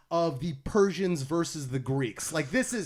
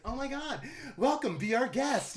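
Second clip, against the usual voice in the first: high, sugary greeter voice; chirpy cast member; 8 semitones higher than usual. Retold or imitated. Mocking brainwashed Disney staff, high and sugary. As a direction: gush like an overly cheerful theme park worker. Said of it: "they're just like".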